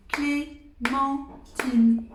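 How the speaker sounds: « Clémentine » découpé très lentement en « Clé-men-tine »; syllabes détachées. Shown as a demonstration. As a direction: frapper chaque syllabe de « Clémentine », plus haut et plus fort. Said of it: "trois syllabes". (« Clémentine » scandé syllabe par syllabe, très lentement)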